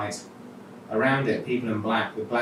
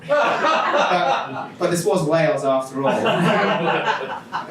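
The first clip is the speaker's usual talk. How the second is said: louder, higher, laughing through the words; 7 semitones higher, 6 dB louder, much slower than usual. Real, not acted. Reader laughs about Wales, laughing. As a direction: giggle through the aside.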